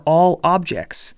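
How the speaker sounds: all objects said slowly, each word weighted; slow down and lean on all objects; emphasis on all objects. Shown as a demonstration. Teacher to students, stressing that these are all objects. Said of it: "The thing I want to emphasize".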